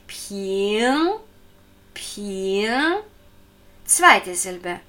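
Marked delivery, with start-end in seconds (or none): 0.1-2.9 Ping rising, ending in a ringing ng; careful Ping with rising tone and humming ng; ringing back-of-tongue Ng. Shown as a demonstration. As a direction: stretch Ping upward into a back nasal ng. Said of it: "klingenden und rückensprachlichen Ng".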